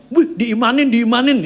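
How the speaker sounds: loud, high mock-impressed exclamation; joking tease. Maid joking with her boss about his faith, loud teasing. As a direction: rib him with a playful high whoop. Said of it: "Dia kan suka bercanda sama saya".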